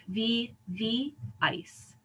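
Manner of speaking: "vi, vi, ice" broken into separate syllables; syllables split apart. Shown as a demonstration. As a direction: pull "vi, vi, ice" apart, vowel from vowel. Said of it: "it's gonna repel and separate".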